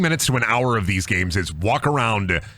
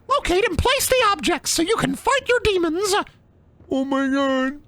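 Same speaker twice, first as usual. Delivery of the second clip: very high cartoon squeak; squeaky put-on voice. Streamer reading the game's objective, squeaky mock voice. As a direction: read it out way up high.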